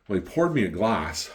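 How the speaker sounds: ordinary talk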